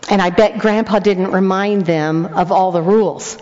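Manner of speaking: normal talking voice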